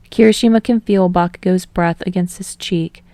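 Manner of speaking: ordinary delivery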